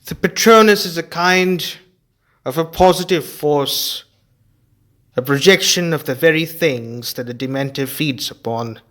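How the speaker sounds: a grave teacher giving a warning; Lupin explaining. Acted.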